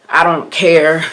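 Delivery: a loud careless shrug of a line; dismissive mock quote. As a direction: brush it off like you've given up. Mocking her old careless self.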